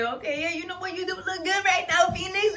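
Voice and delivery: exaggerated sweet compliment from an imagined friend; mock gushing friend. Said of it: "instead of being like".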